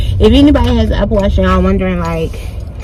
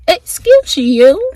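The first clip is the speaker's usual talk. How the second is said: sassy scolding; 10 semitones higher, much slower than usual. Real, not acted. Scolding herself for a slip.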